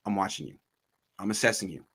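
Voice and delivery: low, soft voice of a watchful boss; a hushed, measured word of warning; quiet warning. Acted. Owner sending the coach a message, quiet warning.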